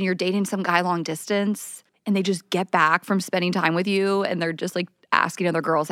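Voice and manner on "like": usual speaking voice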